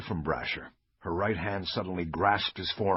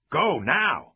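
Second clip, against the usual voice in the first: sharp loud order; 8 semitones higher, 5 dB louder than usual. Acted. Bosch orders frozen officers.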